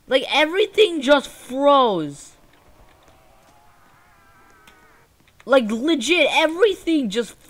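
high-pitched, loud protest; frustrated disbelief. Real, not acted. Gamer stuck with a frozen weapon, frustrated disbelief. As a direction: raise the pitch in baffled exasperation.

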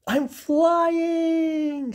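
a bird sure he is finally airborne; joyful cry. Acted. Bernard thinks he is flying.

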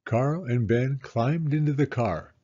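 ordinary delivery

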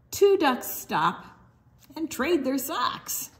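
usual speaking voice

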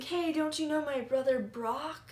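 lightly put-on voice, appealing to a stranger; imitating friend. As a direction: play the friend piping up. Acting friend's plea to would-be robbers.

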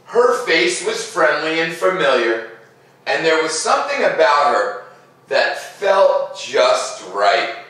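put-on gentle read-aloud to children; storybook narration. A narrator's reading. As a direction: tell it like a bedtime story. Reads picture book aloud.

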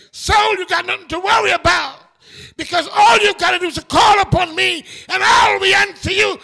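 David's rousing words. Acted. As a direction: call out to Saul to lift him up. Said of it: "David said".

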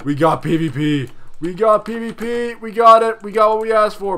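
high, loud thrilled cry after a gasp; gasp, then cheer that the fight is on; gleeful excitement. Real, not acted. Thrilled that an enemy player finally attacks, with a loud high cheer.

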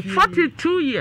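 high, loud, slow cry of disbelief; emphatic exclaiming. Real, not acted. Host crying out the dead man's age to listeners, loud and high. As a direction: hit each word high and loud.

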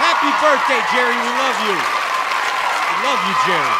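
loud high cheering shout; birthday cheer. Real, not acted. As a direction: cheer it out to the crowd. Hyping crowd for birthday.

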